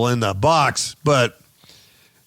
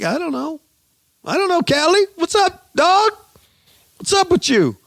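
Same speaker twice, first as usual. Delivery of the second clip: much higher, loud put-on voice; high-pitched mock street greeting; mock R. Kelly; 5 dB louder than usual. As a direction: ham up a squeaky goofy caricature. Mocking R. Kelly's talk show.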